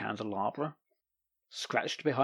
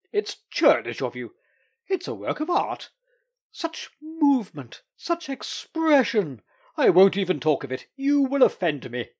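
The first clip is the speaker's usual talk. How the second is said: high, loud and slowed on the praise; vehement; 7 semitones higher, 4 dB louder than usual. Acted. The Doctor arguing the lawyer round, vehement. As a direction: press it with rising fervour. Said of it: "gesticulated more violently".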